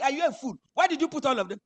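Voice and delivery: loud, high scolding of a fool. Retold or imitated. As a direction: tell him off in disbelief. Retold scolding.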